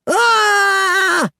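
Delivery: high, loud, long scream of fright; terrified scream. Acted. The boys seeing the mud-covered head, terrified scream. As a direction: scream like you've seen a monster. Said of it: "they screamed".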